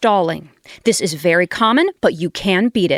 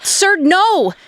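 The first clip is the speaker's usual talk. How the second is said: high alarmed shout of no; alarmed cry; 8 semitones higher than usual. Acted. Coach yells to his drifting writer, high alarmed. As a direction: cry out to stop him.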